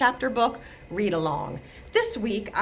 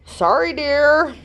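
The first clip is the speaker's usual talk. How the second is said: higher, slow, apologetic husband voice; 8 semitones higher, 5 dB louder, slower than usual. Acted. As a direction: say sorry gently to your wife.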